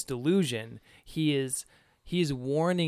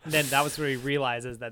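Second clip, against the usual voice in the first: usual speaking voice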